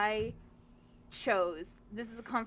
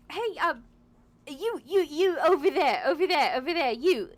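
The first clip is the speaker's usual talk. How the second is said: loud, high, insistent hailing; calling someone out; 5 semitones higher, 5 dB louder than usual. Acted. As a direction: holler at a stranger across the party. Drunk character calls out a stranger.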